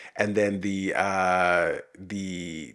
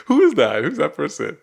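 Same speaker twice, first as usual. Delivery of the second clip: mock surprise; 6 semitones higher, 9 dB louder than usual. Mock reaction to Garfield's accent.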